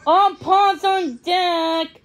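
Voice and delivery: a booming shout to the whole harbour; calling out. A narrator's reading. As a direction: call it across the docks. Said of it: "a voice called out from below".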